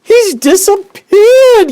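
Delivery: extremely high, loud, slow shriek; a mother yelling as her son vanishes. Acted. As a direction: shriek in horror. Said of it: "yelled Missus Gloop".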